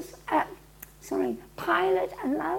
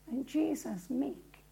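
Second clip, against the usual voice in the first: ordinary delivery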